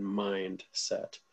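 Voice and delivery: mindset drawn out slowly and deliberately; stress on mindset. Shown as a demonstration. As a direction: lean into the word mindset, said slow and deliberate. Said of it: "I'm gonna emphasize that word again".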